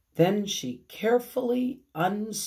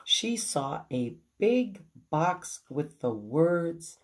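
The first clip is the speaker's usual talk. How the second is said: plain reading voice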